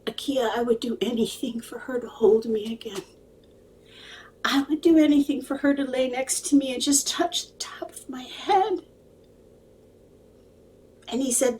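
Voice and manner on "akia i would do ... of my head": soft, mostly whispered, higher plea; barely above a breath, aching; hushed longing. Retold or imitated. Widower begging the psychic to reach his wife, whispered.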